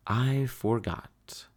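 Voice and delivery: whispered guilty admission; small guilty whisper; sheepish whisper. Acted. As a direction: whisper it like a kid owning up. Sheepish confession.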